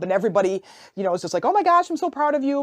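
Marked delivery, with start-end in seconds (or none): 1.4-2.6 high-pitched retold gush of pride; proud gushing. Family hearing about the adoption, high proud gush. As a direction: gush it like an overjoyed relative. Relatives react to adoption news.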